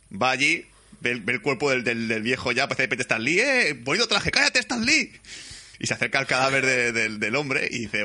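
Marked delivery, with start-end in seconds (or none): 3.4-5.1 mock voices. Retelling Stan Lee cameo dialogue.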